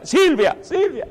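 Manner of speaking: calling out high and loud for Silvia; rising panicked shout for Silvia; frantic call. Retold or imitated. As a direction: call her in from the next room, alarmed. Old man calls wife in panic.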